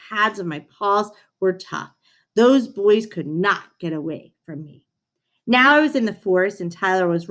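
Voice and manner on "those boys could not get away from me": usual speaking voice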